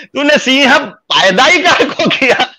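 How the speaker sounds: high, drawn-out theatrical protest; indignant retort. Retold or imitated. Son asks mother why she bore a lion, in mock outcry. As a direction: wail the complaint at her, hammed up.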